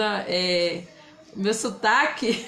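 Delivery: ordinary talk, nothing marked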